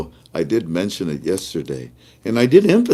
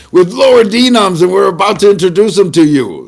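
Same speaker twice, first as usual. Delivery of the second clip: louder, higher proclamation; excited shout; 10 semitones higher, 9 dB louder than usual. Real, not acted. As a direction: shout the big news triumphantly. Announcing the new notes.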